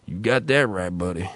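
voicing a Southern stranger lightly; a hint of a Southern local agreeing; retold Southern reply. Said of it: "Southern interaction".

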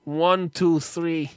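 slow deadpan. Retold or imitated. Mocking the vendor's fake extension.

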